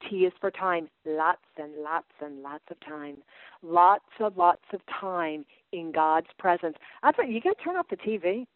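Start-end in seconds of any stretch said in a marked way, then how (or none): 1.1-6.8 slow emphatic lots. Acted. Stressing need for time.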